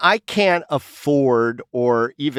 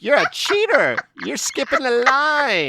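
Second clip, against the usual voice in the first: high mock-accusing voice; mock scolding; 13 semitones higher than usual. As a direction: play the shocked friend wagging a finger. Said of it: "Vogue's like".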